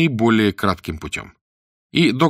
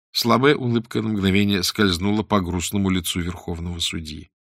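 ordinary delivery

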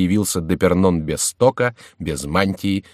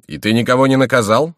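raised, louder, curious query; curious, pitched up; interested question; 5 semitones higher, 5 dB louder than usual. A narrator's reading. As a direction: ask with keen interest. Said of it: "с интересом спросил Мажерон".

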